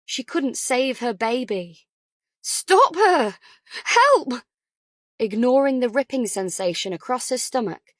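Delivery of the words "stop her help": high frantic shouts for help. Acted. Ellie screaming to the ward for help, frantic high shout. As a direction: scream for someone to stop her.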